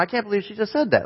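high, quick inner astonishment; disbelieving. Retold or imitated. Chaperone stunned by a girl's request, quick acted disbelief. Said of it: "you're sitting there going".

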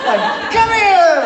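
hammed-up angry mom summoning the kid; mom with sandal. Mother with sandal in hand calling her child, hammed-up yell. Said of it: "they take the sandal off".

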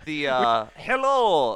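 put-on high, loud spooky German accent; spooky German man voice. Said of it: "Very spooky German man, according to me".

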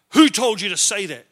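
loud, confrontational challenge; aggressive demand. Retold or imitated. Big biker confronting a stranger in a pub, aggressive. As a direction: fire the question at him loudly. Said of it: "real aggressive".

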